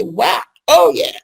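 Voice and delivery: high, loud and slow mock outrage; drawn-out sarcastic wow, building to a fight; sarcastic road rage. Driver cut off on the road, loud mock outrage. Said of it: "you say".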